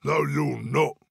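contradicting storybook troll; put-on troll's firm refusal. A narrator's reading. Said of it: "said the Troll".

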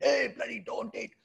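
high scolding yell; parent yelling. Retold or imitated. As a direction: bark at the child from up high. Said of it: "yells at you".

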